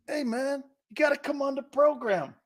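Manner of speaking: high-pitched mock plea; put-on high voice. Host mimicking a guy nagging his buddy, high put-on voice. Acting out what he'll say.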